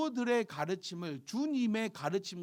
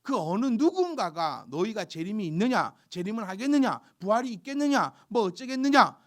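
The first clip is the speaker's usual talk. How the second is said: rising higher and louder toward a loud, high peak; building preaching; 5 semitones higher, 8 dB louder than usual. Acted. Preacher to congregation, building from firm to loud high fervour. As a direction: drive the mockers' questions home, building.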